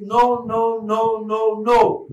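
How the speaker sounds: rising loud repeated no; emphatic no. Real, not acted. Preacher loudly rejecting the congregation's excuses. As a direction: shut the excuse down hard.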